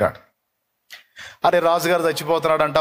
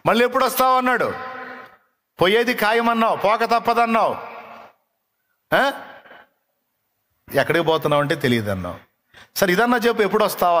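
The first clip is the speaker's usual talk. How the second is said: fool asking naively; 6 semitones higher than usual. Acted. Fool asks the dying king when he will return, high and insistent. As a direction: keep asking like a simple fool.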